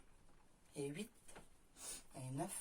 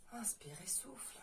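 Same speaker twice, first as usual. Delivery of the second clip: soft whispered breathing cue; whispering; 11 dB quieter than usual. Shown as a demonstration. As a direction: whisper it gently to the class. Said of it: "coach cue, then whispered repeat".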